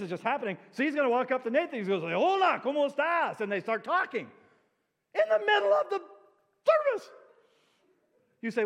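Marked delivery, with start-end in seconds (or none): 2.0-3.4 high, booming shouted hello; loud greeting. Acted. Acts out loud greeting mid-service. 5.1-7.1 very high, very loud yell; hammed-up scandalized holler; incredulous shout. Mock outrage at talking over service.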